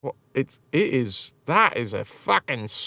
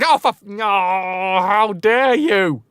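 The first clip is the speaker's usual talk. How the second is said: mock outrage; 5 semitones higher, 3 dB louder, slower than usual. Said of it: "I was like".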